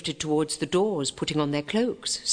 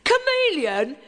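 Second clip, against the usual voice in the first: higher, louder amused echo; grinning boot boy; 6 dB louder than usual. A narrator's reading. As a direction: echo it with a grin. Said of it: "echoed the boot boy with a grin".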